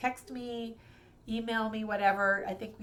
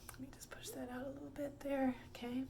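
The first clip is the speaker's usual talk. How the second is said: whispered under her breath, high; a quick whispered aside to herself; 6 semitones higher, 10 dB quieter, faster than usual. Real, not acted. Muttered aside while adjusting something.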